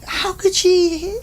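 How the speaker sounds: high, slow, stunned question; baffled disbelief. Retold or imitated. Ram Dass baffled that the lady understands, high and stunned. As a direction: voice Ram Dass's bewildered double take.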